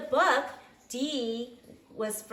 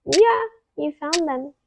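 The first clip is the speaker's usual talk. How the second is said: usual speaking voice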